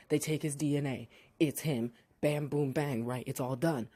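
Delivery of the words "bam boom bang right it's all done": usual speaking voice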